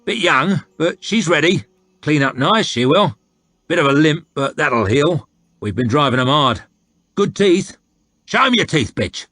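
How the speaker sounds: high, loud haggling soldier; coarse slaver. Acted. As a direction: push the sale like a crude trader.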